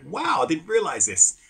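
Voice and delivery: mock amazement, pitch leaping; amazed customer. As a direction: voice an impressed customer, pitched well up. Customer amazed on hearing bar story.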